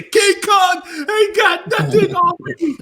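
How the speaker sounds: slow, high, loud chest-beating shout; Training Day shout. Retold or imitated. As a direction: roar it like Denzel in Training Day. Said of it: "I thought he was gonna say".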